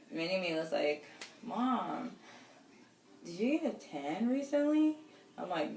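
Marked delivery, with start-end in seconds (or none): 1.4-5.0 lightly voiced young daughter, higher; a hint of a curious little girl; daughter's voice. Mom retelling her daughter asking about a tan, lightly higher.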